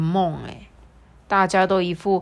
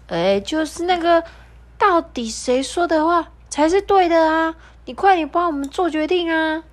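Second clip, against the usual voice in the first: high parrot voice; 10 semitones higher, 4 dB louder than usual. Acted. Parrot presses the zebra.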